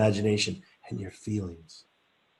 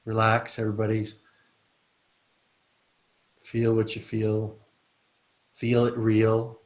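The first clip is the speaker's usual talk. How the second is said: guided relaxation. Acted. Leads a relaxation exercise.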